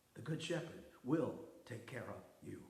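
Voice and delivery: quiet reassurance to the congregation; hushed. Shown as a demonstration. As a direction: say it gently to the listener. Hushed echo after a loud refrain.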